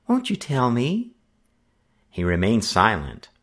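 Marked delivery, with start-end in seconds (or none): 0.1-1.1 high, soft and tender. A narrator's reading. Little sister coaxing her brother, soft and tender. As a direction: ask gently, coaxing him. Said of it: "she asked softly".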